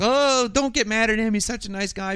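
high-pitched retold plea; mock pleading. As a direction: plead his case in a high voice. Groupie pleading with angry Donna.

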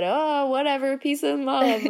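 mock an easygoing hippie attitude; imitated carefree girl. Quoting a fan on her laid-back sister, imitated carefree girl.

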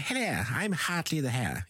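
high, silly puppet hare voice; lightly voiced kids' TV puppet; Hartley the Hare. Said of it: "he's like".

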